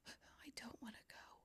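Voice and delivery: put-on small whispered child's plea; hushed, pitiful child voice; whimpering kid. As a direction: whisper it like a sad little kid. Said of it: "she looked at me like".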